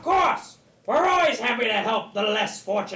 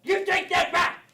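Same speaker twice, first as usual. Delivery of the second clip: angry shout; 6 semitones higher than usual. Acted. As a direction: yell it at him furiously. Puppet fight.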